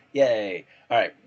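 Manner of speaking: loud happy yay; excited cheer. Real, not acted. Camera finally works.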